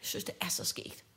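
breathy whispered repeat of "skægt"; whispered aside, voiceless. Real, not acted. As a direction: mutter it to yourself under your breath.

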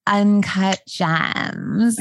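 slow drawled New York vocal fry; Julia Fox impression. Mocking Julia Fox's podcast line, slow New York drawl. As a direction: stretch it out like a bored New York it-girl. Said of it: "That is a great impression".

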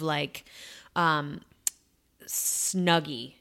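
usual speaking voice

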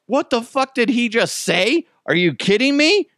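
high, incredulous outburst; shocked booth reaction; high mock disbelief at a gaffe. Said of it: "everybody in the booth was just like".

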